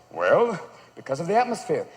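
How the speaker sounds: low, measured dad answer; patient parent. Retold or imitated. Dad answering his three-year-old, low patient voice. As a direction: explain it low to a small child. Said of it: "when they ask you about everything".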